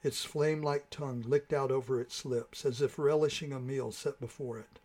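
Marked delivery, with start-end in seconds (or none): none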